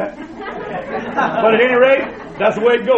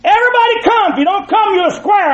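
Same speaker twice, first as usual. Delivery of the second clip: high, loud mock announcement; hammed-up party call, higher and louder; mock sign shout; 11 semitones higher, 5 dB louder than usual. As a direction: bellow the sign's invitation, a bit hammed-up. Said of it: "this big sign over it says".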